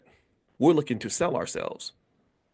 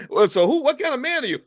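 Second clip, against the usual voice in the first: mock coach question; 11 semitones higher, 4 dB louder than usual.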